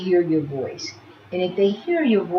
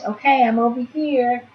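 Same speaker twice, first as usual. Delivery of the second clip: reassuring; 6 semitones higher, 4 dB louder than usual. Shown as a demonstration. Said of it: "if that baby hears your voice".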